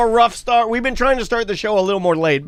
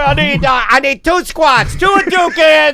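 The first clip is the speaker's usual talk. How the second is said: diner cook calling orders; 6 semitones higher than usual. Retold or imitated.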